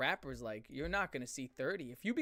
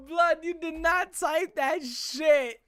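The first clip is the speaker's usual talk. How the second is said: high, loud and slow, almost shouting; outraged disbelief; 13 semitones higher, 8 dB louder, slower than usual. Real, not acted. Scolding the songwriter over the lyric, high loud outrage. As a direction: drag out each word in scandalized outrage.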